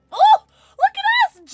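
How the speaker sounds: high, loud, slow excited cry; excited shout. Real, not acted. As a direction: shout with delight at the match. Excited greeting two Jakes.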